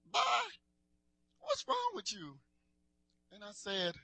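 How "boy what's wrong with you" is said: much higher, concerned mom voice; imitating his mom. Mom asking her sad son what's wrong, high and concerned. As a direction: ask like a worried mother, pitched up. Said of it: "that's how she talked".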